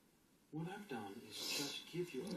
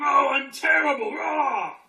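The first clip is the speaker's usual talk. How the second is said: much higher, much louder, crying out "Oh, I'm terrible, rah!"; intense anguished shout; 10 semitones higher, 19 dB louder, slower than usual. Shown as a demonstration. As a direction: let the block howl with full intensity. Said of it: "It's going".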